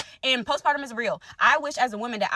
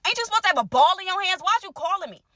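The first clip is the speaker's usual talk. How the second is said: higher, quick, sarcastic comeback; sassy retort; 6 semitones higher, faster than usual. Retold or imitated. Answering her boyfriend's call, higher and sarcastic. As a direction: brush him off with snark.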